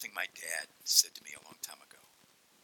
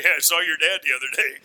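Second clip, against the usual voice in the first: teasing schoolkid; 7 semitones higher, 11 dB louder than usual. Acted. Schoolkid teasing about the dad.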